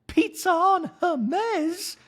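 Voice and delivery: high, slow, marvelling mock voice; Dorit impression. Hostess admiring her plates, slow high mock voice. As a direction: stretch it out like a proud hostess. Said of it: "Dorit's talking to herself".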